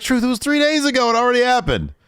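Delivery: very high, loud protest; mock exasperation. Wrestler telling confused rival it's over, high mock exasperation.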